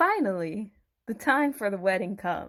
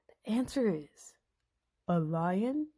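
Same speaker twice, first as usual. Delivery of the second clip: whispering; 4 dB quieter than usual. Acted. Telling the secret answer.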